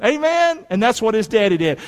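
normal talking voice

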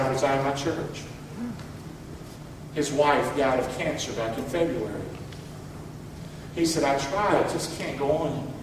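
low, subdued voice of a weary friend; understated sorrow, retold softly; retold grief. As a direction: gently quote a heartbroken man, quietly. Grieving pastor's phone call retold.

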